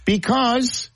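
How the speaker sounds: high-pitched stats pitch; high register. Pretend. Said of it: "still gotta go high register".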